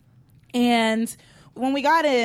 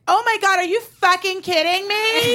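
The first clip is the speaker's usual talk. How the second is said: mock outrage at the drunk guy, pitched up; outraged friends; 8 semitones higher than usual.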